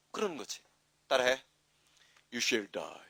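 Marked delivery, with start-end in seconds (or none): 2.3-3.0 deep English model line for repeating; put-on low voice. Shown as a demonstration. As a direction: drop into a low actor's register. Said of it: "안 하던 거 나오니까 이상하다". (낮게 깐 배우 목소리로)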